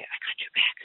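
breathy whispered reassurance. Retold or imitated. Holy Spirit reassuring her in a whisper. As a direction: whisper it close in the ear. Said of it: "that little whisper in my ear".

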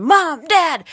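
put-on a kid announcing big news; excited call. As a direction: burst through the door calling out. Son bursts in healed.

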